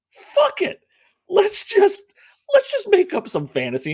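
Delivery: high, loud careless mock voice. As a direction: ham up a careless filmmaker. Mock quote of movie makers.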